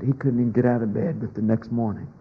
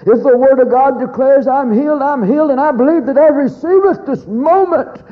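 a loud declaration that climbs higher and louder as it goes; loud scream; 7 semitones higher, 7 dB louder than usual. Retold or imitated. Injured logger shouting his healing declaration before God. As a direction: cry it out to heaven with all your strength. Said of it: "he screamed in a loud voice".

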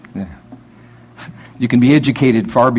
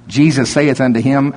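usual speaking voice

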